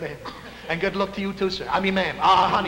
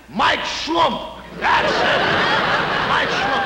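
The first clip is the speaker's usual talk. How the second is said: high loud triumphant cry; eureka shout; 8 semitones higher, 5 dB louder, much slower than usual. Acted. Scout shouts the new name he just invented, triumphant. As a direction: yell it like a discovery.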